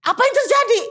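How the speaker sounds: high, urgent chief's question; the chief bursting in demanding answers; alarmed chief. Retold or imitated. (kepala suku bertanya dengan panik)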